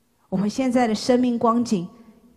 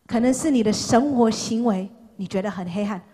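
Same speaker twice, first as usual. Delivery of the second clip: normal talking voice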